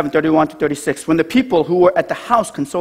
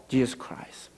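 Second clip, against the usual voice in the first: hushed whisper; 3 semitones lower, 12 dB quieter, slower than usual. Real, not acted. Preacher to congregation, hushed whisper. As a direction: whisper it, barely voiced.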